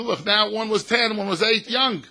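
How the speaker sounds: loud, slow and emphatic; loud lesson to the grandkids; loud slow explaining to kids. Retold or imitated. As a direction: call the kids in and spell it out.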